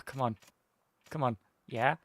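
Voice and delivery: usual speaking voice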